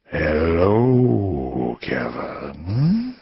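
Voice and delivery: low and breathy, each syllable drawn out; a menacing caller savouring the name; low breathy man's voice. Acted. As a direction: stranger on the phone greets Kevin, low and breathy. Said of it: "low and breathy".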